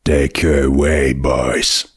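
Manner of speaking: low voiced sheriff giving orders; sheriff ordering. A narrator's reading.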